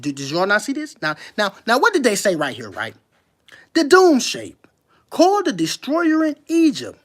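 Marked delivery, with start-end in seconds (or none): 3.7-4.7 dramatic emphasis. Real, not acted. Reading the same phrase, louder. 5.1-7.0 emphatic reading. Real, not acted. Rereading the phrase, emphatic.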